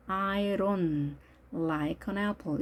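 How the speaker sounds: "I don't" as "I ron", T dropped; soft linked pronunciation. Shown as a demonstration. Teacher modelling fluency, "don't" softened to "ron". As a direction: slowly glide through "I don't like an apple", D as soft R. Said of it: "이렇게 발음을 부드럽게 해주시면".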